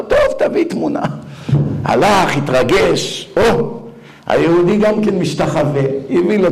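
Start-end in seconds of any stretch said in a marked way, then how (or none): 0.0-1.0 fast, very high mock rabbi voice; rabbi's brush-off. Rabbi humoring the singer, fast and high. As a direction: toss it off quickly, high. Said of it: "אמר לו".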